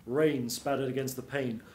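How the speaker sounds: ordinary delivery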